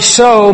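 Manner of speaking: preacher lands on so; stress on so. Shown as a demonstration. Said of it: "that little word".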